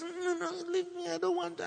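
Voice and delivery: high, whimpering mock tearful plea; imitated crying. Upset wife pushing joking husband away, mock tearful. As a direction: act out a timid wife crying, pitched very high. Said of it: "she starts crying".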